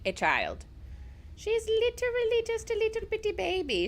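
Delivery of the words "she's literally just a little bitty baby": put-on high baby voice cooing at the cat; light baby talk for a pet.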